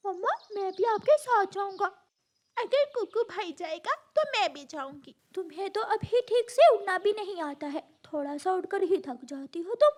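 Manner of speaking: high, childish cartoon voices; squabbling young birds. Children arguing over going with mother, squabbling. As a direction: bicker like insistent small siblings.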